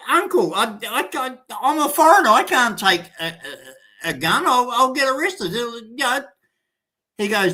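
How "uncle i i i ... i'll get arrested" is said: high, fast flustered protest; mock panic. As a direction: sputter like a scared foreigner. Panicked foreigner refusing the gun.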